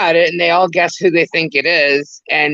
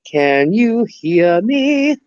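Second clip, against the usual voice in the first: very slow, stretched words, pitched higher; drawn-out sound check; 4 semitones higher, much slower than usual. Real, not acted. Checking whether Kendra can hear, slow calling. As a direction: draw out each word to reach a lost listener.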